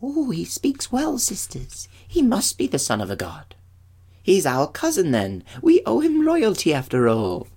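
high-pitched nymph voice; admiring nymph. Acted.

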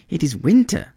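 lightly voiced bird, pitched high; a high, protesting reply; earnest swallow. A narrator's reading. Swallow explains winter to the prince, earnest. Swallow argues it must leave.

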